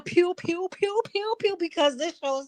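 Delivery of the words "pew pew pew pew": high mock gunfire pew pew. Mocking cast, high pew pew gunfire noises. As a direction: fire off pew pew sounds high and quick.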